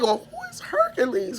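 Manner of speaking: put-on high, laughing disbelief from the kids; confused kids. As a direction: kids asking "who is Hercules?" with an amused squeal.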